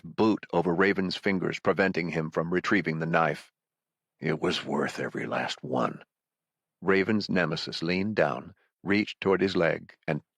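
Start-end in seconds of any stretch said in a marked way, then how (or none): 4.2-6.0 low, soft, cold villain voice. Acted. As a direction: say it quietly, savouring it. Villain Eddie answers coldly.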